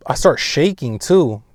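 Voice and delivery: normal talking voice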